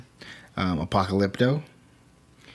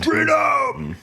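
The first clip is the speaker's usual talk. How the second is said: high, loud battle cry; Braveheart freedom yell; 5 dB louder than usual. Retold or imitated. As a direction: scream it like a dying hero.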